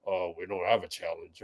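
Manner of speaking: low, soft mumbled-down impression; imitating a very deep-voiced player; Wiggins's deep voice. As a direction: drop into a deep, quiet voice. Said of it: "how deep Wiggins's voice is".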